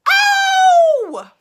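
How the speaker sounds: very high, very loud yelp; sharp pained yelp; yell of pain. Acted. Bruno yelping from a hat pin, very high. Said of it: "And he yelled".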